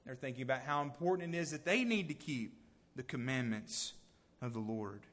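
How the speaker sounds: ordinary talk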